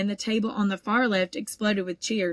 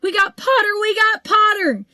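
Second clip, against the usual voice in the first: loud, very much higher gleeful yell; 13 semitones higher, 8 dB louder than usual. A narrator's reading. Weasley twins cheer over Harry, gleeful yelling. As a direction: crow it out in triumph. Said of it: "the Weasley twins yelled".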